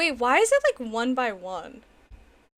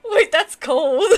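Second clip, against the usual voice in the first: laughing; 3 semitones higher, 10 dB louder than usual. Real, not acted. Laughing in shock at gifted subs.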